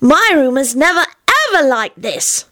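put-on high, awed little-kid voice; high-pitched childish wonder; rapturous child. A narrator's reading. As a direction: marvel like a small child. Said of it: "with a rapturous expression".

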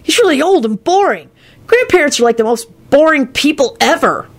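cheeky, high-voiced whine; rude blurt. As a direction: complain rudely like a bored kid. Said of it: "Kenny blurted out rudely".

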